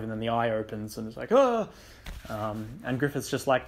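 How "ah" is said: sudden high, loud mock yelp of fright; startled scream. Guts startled as the necklace eye opens, yelping. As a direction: jump back with a quick cry.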